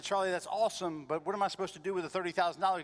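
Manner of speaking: excited disbelief. Retold or imitated. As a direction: rush it out, thrilled and baffled. Astonished reply on phone.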